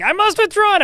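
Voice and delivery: shrill very high shouted retreat cry; screaming Minotaur. Retold or imitated. Player imitating a fleeing Minotaur in a screenshot, shrieked cry. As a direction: yelp it like a panicked monster fleeing. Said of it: "the Minotaur is screaming".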